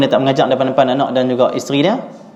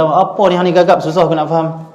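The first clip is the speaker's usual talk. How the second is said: mock scolding; 5 semitones higher than usual. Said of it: "dia kata".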